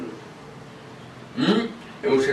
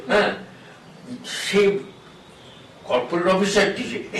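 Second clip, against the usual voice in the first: normal talking voice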